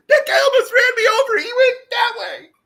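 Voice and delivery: high, loud, wailing fake sob. Older woman fakes crying to the police, wailing high sob. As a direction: whimper it like crocodile tears for the cops.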